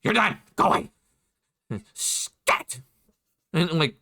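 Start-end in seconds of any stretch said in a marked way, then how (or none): 0.0-2.8 lightly voiced goblin shooing someone off; a hint of a jittery goblin, ending in a hissed dismissal; twitchy goblin. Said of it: "almost twitching in a way".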